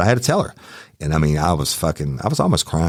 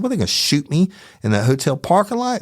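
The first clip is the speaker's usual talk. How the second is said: incredulous; 11 semitones higher than usual. Retold or imitated. Incredulous reply to wife.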